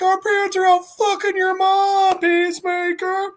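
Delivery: very high mocking child voice; high, held monotone taunt; roasting kids. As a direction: jeer back in a squeaky kid pitch. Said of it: "And they're like".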